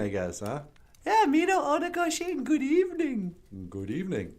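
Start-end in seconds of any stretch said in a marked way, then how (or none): 1.1-3.4 high puppet bonjour. Acted. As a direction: squeak it out like a bubbly puppet. Puppet character voice.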